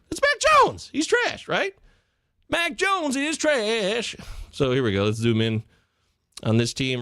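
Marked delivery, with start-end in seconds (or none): 0.1-4.2 very high, squealed mock outrage; hammed-up falsetto complaint, stretched at the end; mock exasperated rant. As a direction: whine it way up high in comic despair. Acts out ranting over a player.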